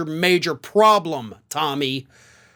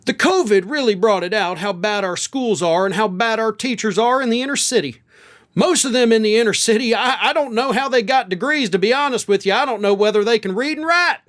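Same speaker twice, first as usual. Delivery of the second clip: exaggerated Southern American accent; Tommy Tuberville; 2 semitones higher than usual. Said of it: "I'm using that accent".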